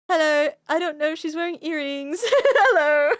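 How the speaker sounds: laughing mock Toad voice; Mister Toad impression. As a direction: play the oddball toad greeting everyone with hello. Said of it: "And Mister Toad just says".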